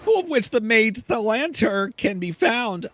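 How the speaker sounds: exasperated high-pitched chicken mage; very high, flustered talking chicken; Mellencamp. Acted. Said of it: "my Mellencamp voice".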